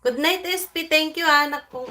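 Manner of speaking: loud high goodnight; cheerful goodnight. Real, not acted. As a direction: call out thanks warmly.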